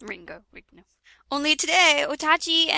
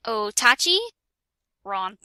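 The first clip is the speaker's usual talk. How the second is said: ordinary delivery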